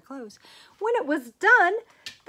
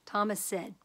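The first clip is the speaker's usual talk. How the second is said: usual speaking voice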